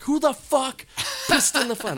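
high, loud outraged impression; hammed-up indignant yell; mock outrage. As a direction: imitate a furious host, pitch shooting up. Acting out the angry group message.

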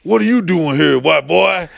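tough guy. Retold or imitated. Security guy confronting him.

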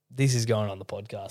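dropped low and soft; low aside. Retold or imitated. Telling friends his reaction to the second joke, low and soft.

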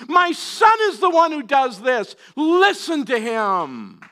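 preacher voicing God, slow and thundering. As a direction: proclaim each word like God from the cloud. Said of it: "hear the voice of the Spirit of God saying".

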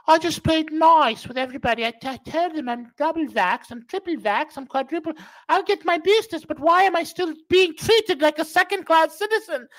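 high whiny mock voice. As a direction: parody the complaining man. Mock quote of Ferguson.

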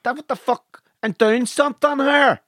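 high-pitched, hammed-up irate barman; angry barman. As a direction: fob off a customer with mock outrage. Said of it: "Angry barman".

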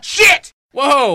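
high, loud curse of fright; startled yell. Acted. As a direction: yelp it out in shock. Startled by the scream.

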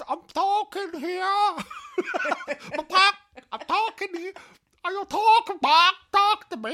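very high, loud squawking chicken; laughing hammed-up chicken squawk. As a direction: cluck the words out like a talking chicken. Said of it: "He's turned into a chicken now".

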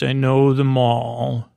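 slow, deliberate retold line; pointed, knowing. Boothby stopping Picard short, knowing and slow. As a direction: say it slowly, weighting each word. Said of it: "This just stops Picard short, uh, with the way he says it".

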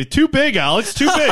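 laughing protest, pitch flying up; high laughing squeal. Real, not acted. Laughing protest at camera zoom.

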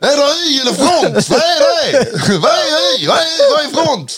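high mock outrage at underwear; Adde Malmberg impression. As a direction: play the baffled comedian, nearly crying. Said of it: "våran imitation var ganska bra i början".

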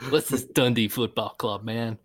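mock American accent, playfully baffled; Tab Ramos American accent. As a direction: put on a jokey American accent. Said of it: "I didn't make an accent".

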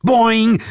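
high, loud springy boing; shouted bouncy sound effect; loud cartoon boing. A narrator's reading. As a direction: pop up like a jack-in-the-box. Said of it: "Machte mein Partner".

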